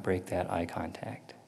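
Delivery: hushed whisper. Real, not acted. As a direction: drop to a whisper, spooked. Eerie eye contact moment.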